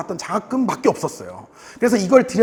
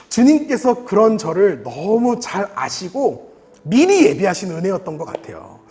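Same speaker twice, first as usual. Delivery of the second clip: ordinary talk, nothing marked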